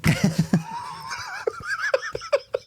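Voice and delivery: very high shrieking laughter. Real, not acted. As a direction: scream with laughter. Laughs at own joke.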